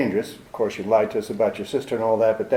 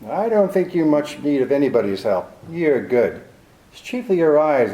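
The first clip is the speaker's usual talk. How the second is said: ordinary talk, nothing marked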